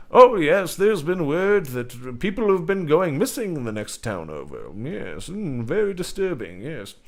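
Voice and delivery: lightly voiced, high conspiratorial villager; light NPC voice sharing rumours; secretive tavern NPC.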